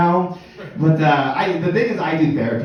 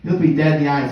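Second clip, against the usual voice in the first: ordinary talk, nothing marked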